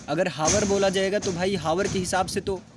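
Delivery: normal talking voice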